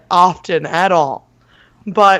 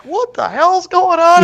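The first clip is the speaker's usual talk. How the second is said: confused dad; 11 semitones higher than usual. Retold or imitated. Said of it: "poor naked Ted is like".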